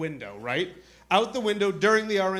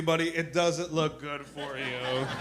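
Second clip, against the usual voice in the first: slow, lower mock-grave delivery; grave slow verdict; 4 semitones lower, 6 dB quieter, slower than usual. Imitating cop telling kid bad news, slow and grave.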